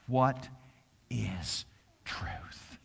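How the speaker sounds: a quiet, half-whispered scornful question. Retold or imitated. Pilate sneering at Jesus, hushed and scornful. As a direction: scoff at it under your breath. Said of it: "Pilate said to him scornfully".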